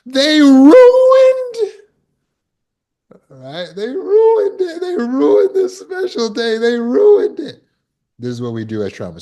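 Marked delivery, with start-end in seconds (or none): 0.1-1.7 very high, loud hammed-up cry; mock outraged wail. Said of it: "We go". 3.8-7.6 high, slow hammed-up self-pity; mock indignant whine. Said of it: "We go".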